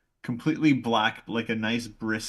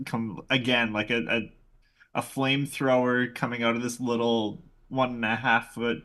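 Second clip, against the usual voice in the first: normal talking voice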